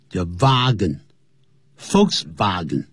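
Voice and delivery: Wagen said slowly with a V sound, vahgen; German Wagen. Shown as a demonstration. Teacher drills German W as V, slowly. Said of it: "在德文里头要念成V的音".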